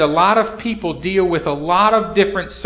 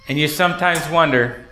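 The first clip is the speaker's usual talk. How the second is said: usual speaking voice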